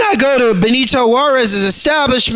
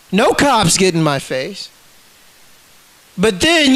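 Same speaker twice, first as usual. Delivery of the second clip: ordinary talk, nothing marked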